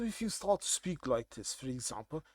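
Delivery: softly put-on posh English accent; posh English accent, kept low. Shown as a demonstration. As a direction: demonstrate a posh accent, understated. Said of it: "you're so posh".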